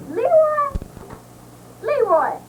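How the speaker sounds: calling out. Acted. Calling into the phone.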